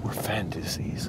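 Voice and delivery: slow, soft, hammed-up whisper; mock seductive whisper. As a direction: whisper it slowly, faking seduction. Mock seductive pleasure-zone bit.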